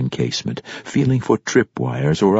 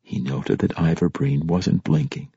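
usual speaking voice

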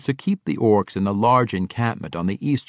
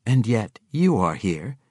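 dry remark. Acted. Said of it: "Catti Bree said dryly".

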